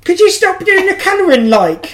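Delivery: high-pitched, hammed-up Cockney nag; mock your co-host in a squawked Cockney accent, half laughing; Eliza Doolittle impression. Host imitating Michelle telling off the colouring, hammed-up Cockney. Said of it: "Eliza Doolittle impression".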